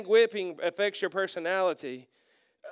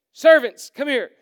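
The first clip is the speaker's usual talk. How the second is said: much louder and higher call across the yard; yelling for servants; 5 semitones higher, 11 dB louder than usual. Retold or imitated. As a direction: shout for the servants to come running. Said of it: "He yells".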